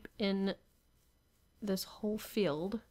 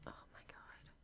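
exasperated whisper; 25 dB quieter than usual. Real, not acted. Frustrated aside.

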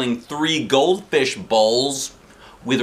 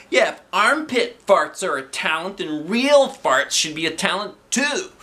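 put-on protesting kid; 8 semitones higher than usual. A narrator's reading.

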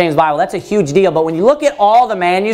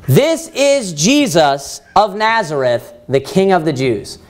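slow inscription reading; slower than usual. Shown as a demonstration. Preacher revealing the full combined title on the cross, slow and weighty. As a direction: spell out the whole title slowly for the church.

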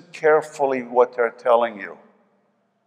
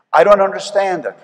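louder and higher, pleading with heaven; I don't understand it, called out loud; emphatic cry; 7 semitones higher, 6 dB louder than usual. Real, not acted. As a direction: throw it out loud in frustration. Pastor repeats, first loud.

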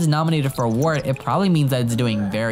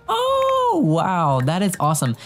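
surprised delight; 7 semitones higher, slower than usual. Real, not acted. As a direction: genuinely caught off guard by good news. Grade A news pops up.

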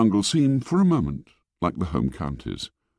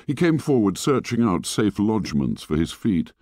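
plain reading voice

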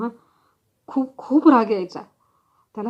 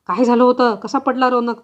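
loud, furious demand; angry shout; 3 semitones higher, 7 dB louder than usual. Acted. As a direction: yell the question while shaking her. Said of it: "रागाने मला गदागदा हलवलं आणि ओरडला". (रागाने ओरडून विचारणे)

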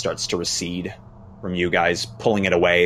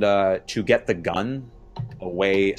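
ordinary talk, nothing marked

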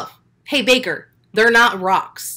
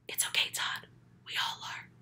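whispered, soft as a secret; mock consoling whisper; 16 dB quieter than usual. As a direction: whisper it like a conspiratorial aside. Mock-consoling the coach in an aside.

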